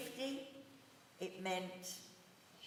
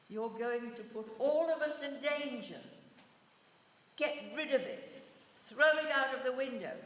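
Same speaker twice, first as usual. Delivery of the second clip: raised, higher, louder voice; retold shout; 5 semitones higher, 8 dB louder than usual. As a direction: re-enact an urgent shouted order. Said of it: "she shouted at this woman".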